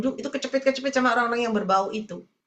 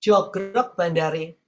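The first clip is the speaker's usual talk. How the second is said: slow, low Javanese aside; Javanese saying; 7 semitones lower, much slower than usual. Retold or imitated. Preacher quoting what Javanese people say, slow Javanese. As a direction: quote it slowly in low Javanese. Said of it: "Katanya orang Jawa gini".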